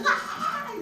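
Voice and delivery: loud, very high "ah"; hammed-up yelp of suspense; frustrated squeal. Actress hears she hasn't got it, high squeal. Reacting to no news yet.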